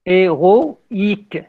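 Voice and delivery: each syllable of Héroïque spelled out slowly. Shown as a demonstration. Teacher counting syllables for the class, slow and spelled out. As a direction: stretch Héroïque into its separate syllables. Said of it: "vous avez la dernière syllabe".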